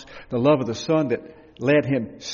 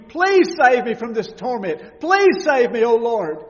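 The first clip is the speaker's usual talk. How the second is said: high, loud desperate pleading; loud begging cry; 8 semitones higher, 6 dB louder than usual. Acted. Preacher voicing a crying child begging, loud high plea. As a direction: beg to be saved from torment.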